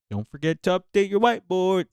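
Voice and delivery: a lightly voiced, sing-song pushy administrator; mocking administrator. Nurse imitating administrators nagging about whiteboards, lightly mocking. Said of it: "all these administrators is like".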